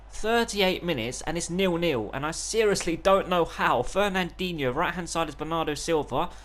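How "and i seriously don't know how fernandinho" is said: ordinary delivery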